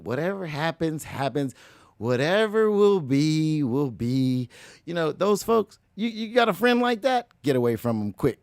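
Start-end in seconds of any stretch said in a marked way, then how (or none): 2.0-4.5 slow, lazy, drawn-out shrug of a voice; mellow floater. Retold or imitated. Mocking blasé drifters to the audience, slow and lazy. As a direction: drawl it like you don't care at all. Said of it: "some folks are just floating".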